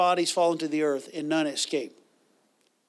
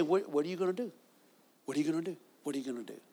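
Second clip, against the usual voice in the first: devil's sly whisper; 2 semitones lower, 10 dB quieter, much faster than usual. Retold or imitated. Preacher voicing the devil's taunt, hushed and sinking. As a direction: let the devil's question sink to a hush.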